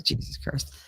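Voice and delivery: under-the-breath whispered Jesus Christ; exasperated whisper. Retold or imitated. Granddaughter muttering at nagging grandma, whispered and fed up.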